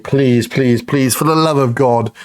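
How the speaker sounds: ordinary talk, nothing marked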